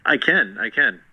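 normal talking voice

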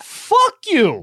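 very high mock teen outburst; hammed-up, squealed teenage defiance; hostile teenager. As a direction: act out a teen cursing a parent, pitch way up. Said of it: "sixteen, and they go".

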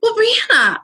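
high scolding voice saying "Brianna"; mock scolding. Guest imitates people scolding her bluntness in a high voice. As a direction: mimic people correcting her tone. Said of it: "And that's what".

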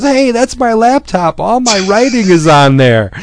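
hammed-up cry of indignation; mock outraged heroine. Heroine yelling at the hitman who grabs her laptop, high and indignant. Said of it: "She goes".

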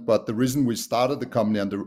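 usual speaking voice

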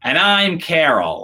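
slow, high, loud punchline; Carol Burnett. Retold or imitated. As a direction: announce the name slowly as a comic reveal. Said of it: "She's like".

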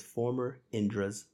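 slow, weighty pronouncement; slow solemn reveal. Retold or imitated. Sage to Indra, pointing at ants, slow and solemn. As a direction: drop it slowly like a final verdict. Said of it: "points at the ants and says".

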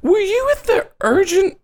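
very high-pitched question; high voice, pausing before the last word; probing character. Acted. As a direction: ask it up high, haltingly. Game master voicing probing character.

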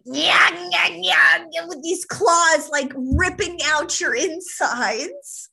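loud, high, hammed-up clawing creature; angry, starving gnome. Gnome clawing at your insides from hunger, angry mock shout. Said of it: "That gnome is angry and starving".

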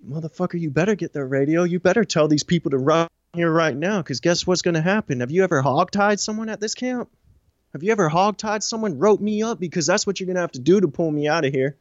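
high-pitched, rapid-fire retold rant; angry threat. Inmate warning officer he must be hogtied, retold rage. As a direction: keep the pitch up and pile on the challenges.

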